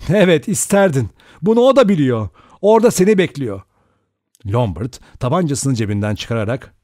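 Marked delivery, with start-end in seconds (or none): 0.1-3.6 loud, high, heated retort; shouting. A narrator's reading. Vera warns Lombard that Armstrong is waiting, shouting. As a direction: shout it back with raised pitch. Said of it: "Vera bağırdı".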